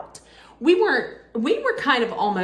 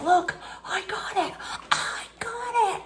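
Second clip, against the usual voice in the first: high, thrilled calls; excited; 13 semitones higher than usual. Retold or imitated. Fan calling to the media guys behind glass, high and excited. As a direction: show off your prize, buzzing with joy.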